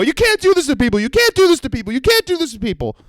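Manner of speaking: very high, repeated outraged chant; mock circling scream. Customer spinning at everyone in the store, high mock screaming. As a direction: loop it as a hammed-up high tantrum. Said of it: "scream in a circle".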